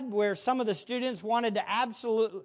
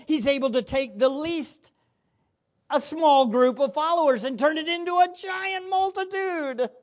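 raised, driving sermon voice; impassioned preaching; 4 semitones higher, 6 dB louder than usual. Real, not acted. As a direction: build the proclamation to a high, emphatic peak. Preaches at full force.

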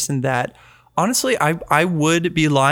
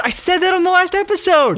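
high insistent; 4 dB louder than usual. Real, not acted. Insisting he called it.

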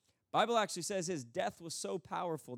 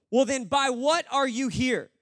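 slow, loud, raised demand; exasperated angel; 6 semitones higher, 8 dB louder than usual. Acted. Angel finally demanding the thief's grounds, exasperated. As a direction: lean on each word in exasperation. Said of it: "the angel would finally say".

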